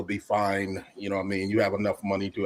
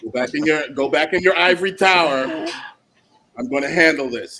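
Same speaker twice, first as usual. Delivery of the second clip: mock parent shout; 5 semitones higher, 7 dB louder than usual. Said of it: "All right?".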